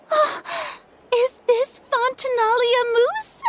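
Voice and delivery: thrilled to spot a favorite dessert; squealing recognition of a treat; delighted. Acted. Delighted at a surprise gift.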